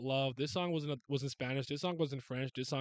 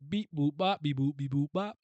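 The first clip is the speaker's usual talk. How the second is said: robot beeps; 2 semitones higher, 4 dB louder than usual. Pretend. Imitating electronic music.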